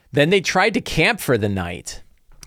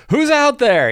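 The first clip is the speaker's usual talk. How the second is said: much higher, louder mock yell into the dark; 5 dB louder than usual. Player's character on watch calls out to orcs, mock yell. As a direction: call out to unseen orcs, hammed up. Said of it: "he yells at them".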